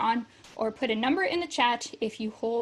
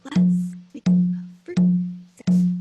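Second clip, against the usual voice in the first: whisper; 6 semitones higher, 7 dB louder than usual. Shown as a demonstration. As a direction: whisper the line back on the beat. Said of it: "when I say it in a whisper voice".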